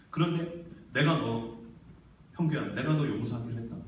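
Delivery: hushed, halting resolve to forgive; gentle divine father. Acted. God gently announces forgiveness.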